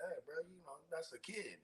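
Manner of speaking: very hushed, retold murmur; discreet aside. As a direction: drop right down for a private word. Retold discreet word to Warrior.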